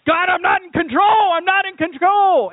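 a frustrated leader crying out to God; exasperated Moses. Retold or imitated. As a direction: Moses pleading loudly with God over whiny Israelites. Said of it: "dramatized Moses".

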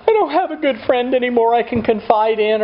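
lonely inner voice. Retold or imitated.

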